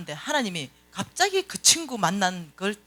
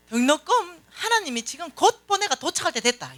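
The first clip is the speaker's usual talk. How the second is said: confident retort; 7 semitones higher than usual. Retold or imitated. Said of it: "요렇게 얘기하는 거야".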